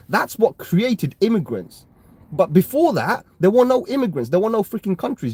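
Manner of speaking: indignant. Real, not acted. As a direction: fire the question back in real outrage. Heated rant about borders.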